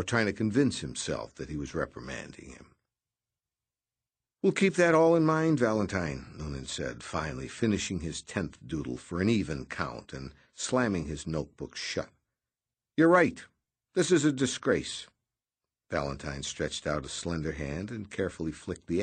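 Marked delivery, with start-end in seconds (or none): 4.4-6.3 higher, brisk, breezy office man; voiced Noonan. A narrator's reading. 12.9-15.1 higher, brisk, breezy office man; voiced Noonan. A narrator's reading. Noonan mock agreeing.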